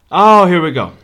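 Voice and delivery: ordinary delivery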